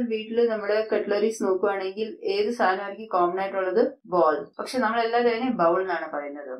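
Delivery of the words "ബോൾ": "ബോൾ" with a long O; correct pronunciation. Shown as a demonstration. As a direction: say "ബോൾ" as bohl. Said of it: "ബോൾ എന്ന് തന്നെ പറയുക". ("ബോൾ" ശരിയായി "ഓ" നീട്ടി)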